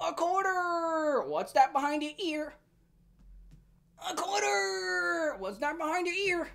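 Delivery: a cartoon grandpa crying out over a coin trick; hammed-up grandpa. Mocking him as a grandpa doing tricks for a kid, hammed-up. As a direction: stretch out "quarter" with a mock cry.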